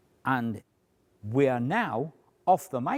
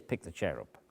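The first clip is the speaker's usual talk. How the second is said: "Pick the chair up" softer and lower, crisp in his ear; as he hears it; 7 semitones lower, 8 dB quieter than usual. Shown as a demonstration. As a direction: let it land soft and clear. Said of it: "And he hears".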